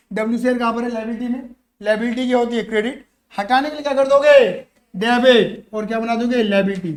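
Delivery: ordinary talk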